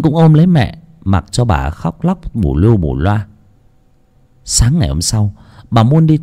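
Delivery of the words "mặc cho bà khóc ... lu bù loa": plain reading voice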